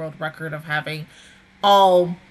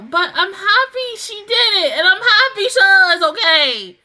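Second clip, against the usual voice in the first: real tearful laugh, much higher and louder; laughing through real happy tears; choked up with relief, voice breaking; crying happy tears; 11 semitones higher, 9 dB louder than usual. Real, not acted. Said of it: "Hell, you got me to cry".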